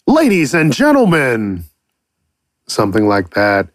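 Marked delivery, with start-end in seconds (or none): 0.1-1.6 announcer. Pretend. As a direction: introduce the show like an announcer. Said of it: "always wanted to be an announcer".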